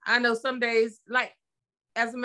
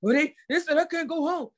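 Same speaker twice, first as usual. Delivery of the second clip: mimicking stressed son; 5 semitones higher than usual. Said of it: "he called huffing and puffing".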